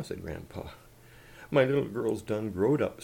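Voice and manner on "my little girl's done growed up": plain reading voice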